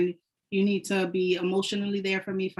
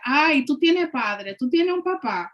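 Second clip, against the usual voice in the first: slow, raised Dominican dad voice; Dominican accent; 6 semitones higher, 5 dB louder than usual. Retold or imitated. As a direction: voice a Dominican father, guilt-tripping. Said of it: "he's like".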